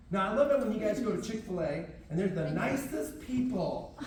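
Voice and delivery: ordinary talk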